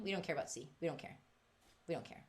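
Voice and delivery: fading to a hush; joking aside to Elaine, fading to near nothing; trailing off. Real, not acted. Fading repetition.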